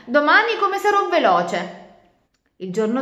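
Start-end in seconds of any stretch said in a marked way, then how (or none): 0.0-1.8 higher, louder cocky promise; annoyed boast. Acted. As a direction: boast to shut her up. (vanto stizzito)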